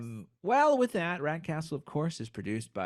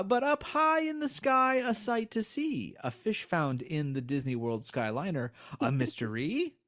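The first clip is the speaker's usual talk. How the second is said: poem reading; 4 semitones higher than usual. Acted. Reads poem aloud.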